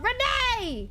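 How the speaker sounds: sharp high cry of a name; frantic high call; panicked call. Real, not acted. Calling out in panic.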